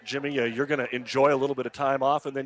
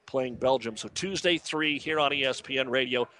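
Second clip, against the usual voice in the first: ordinary delivery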